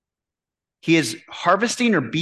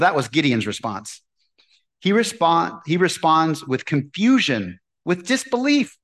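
normal talking voice

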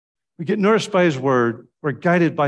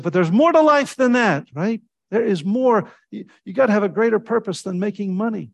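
higher and louder, pressing the point; passionate retort; 8 semitones higher, 5 dB louder than usual. Retold or imitated. Preacher retelling his dinner argument with students, fervent. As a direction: push back hard on the students. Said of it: "And I said".

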